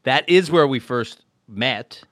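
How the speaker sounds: ordinary talk, nothing marked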